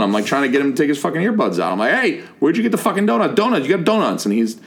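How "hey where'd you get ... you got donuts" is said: high-pitched mock yell, demanding; retold yelling. Storyteller yelling at a kid in earbuds about donuts, mock yell. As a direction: call out urgently without full volume. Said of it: "waving at this kid and yelling".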